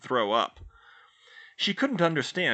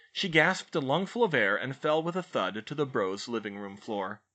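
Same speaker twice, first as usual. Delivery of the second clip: ordinary delivery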